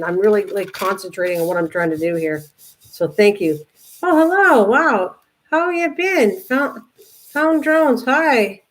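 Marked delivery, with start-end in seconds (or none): 4.0-8.6 much higher, excited greeting; delighted hello. Real, not acted. Greeting arriving viewers in chat.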